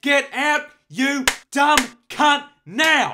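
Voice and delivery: high, loud furious yell; angry yelling. Acted. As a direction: scream him out of the car. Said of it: "Why are you yelling at me".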